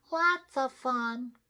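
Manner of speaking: very high, slow little sister; drawn-out squeaky girl. Acted. Sister speaking.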